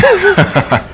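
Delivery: high-pitched short laugh. Real, not acted. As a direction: laugh briefly after the remark. Laughs at coming dodge-only fight.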